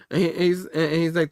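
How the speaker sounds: ordinary delivery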